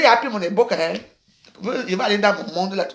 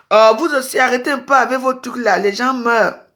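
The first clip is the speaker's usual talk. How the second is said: raised scolding voice; 5 semitones higher, 5 dB louder than usual. Real, not acted. Streamer scolding viewers to stop, raised voice. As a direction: tell them off firmly.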